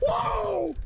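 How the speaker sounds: high-pitched cry of amazement; real high excited holler; amazed whoa. Real, not acted. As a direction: exclaim it high, blown away. Amazed at landed fish.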